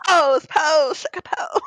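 high mock ballroom chant; ballroom call. Playing ballroom emcee for the friends, excited chant. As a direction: cheer the walkers on.

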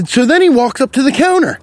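ordinary talk, nothing marked

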